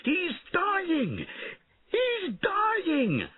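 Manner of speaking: very high excited cries; shrill-pitched peasant shout. A narrator's reading. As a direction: yell it high like an excited crowd. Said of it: "shouted the peasants".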